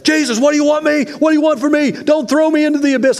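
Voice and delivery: high-pitched, fast shout, imitating a panicked demon; frantic shouting. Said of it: "he shouted at the top of his voice at Jesus".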